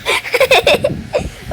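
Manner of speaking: squeaky, breath-filled giggle; high giggle. Real, not acted. Streamer telling viewers the game crashed, high giggle. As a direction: giggle helplessly in a high pitch.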